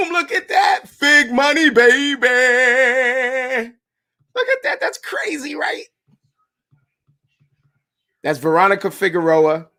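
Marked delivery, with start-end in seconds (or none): 0.1-5.8 much higher, gleeful look at that; amazed reveal. Real, not acted. Showing viewers the necklace photo, thrilled and high. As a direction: show off the picture, thrilled.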